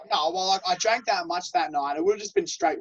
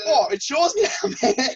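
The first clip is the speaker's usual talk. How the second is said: higher, laughing through it; laughing tease; 7 semitones higher, 5 dB louder than usual. Retold or imitated. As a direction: tease him while laughing. Mates tease cousin.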